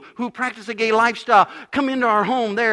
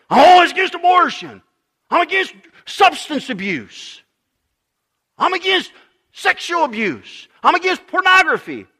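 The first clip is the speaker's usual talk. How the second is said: louder and higher, parroting a smug moralist; mocking self-righteous voice; 6 semitones higher, 6 dB louder than usual. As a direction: announce each "against" like a proud badge. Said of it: "some people are identifying".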